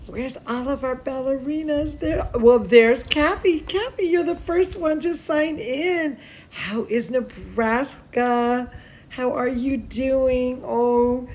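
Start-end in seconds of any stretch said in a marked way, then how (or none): none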